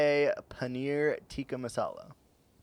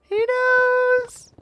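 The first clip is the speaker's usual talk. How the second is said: much higher, loud squeal on "knows"; shrieking; 9 dB louder than usual. Acted. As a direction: shriek it like a crazed conspiracy reveal. Escalating to a shriek.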